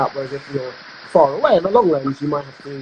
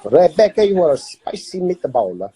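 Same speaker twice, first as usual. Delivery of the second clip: put-on Italian accent; 4 dB louder than usual. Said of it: "Italian voice?".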